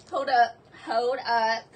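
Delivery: ordinary talk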